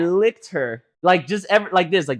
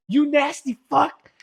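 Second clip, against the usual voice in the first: higher, slow, drawn-out disgust; disgusted insult; 11 semitones higher, slower than usual. Retold or imitated. Friend scolding the girl who licked her, slow disgusted insult. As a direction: spit it out, grossed out. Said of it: "and she was like".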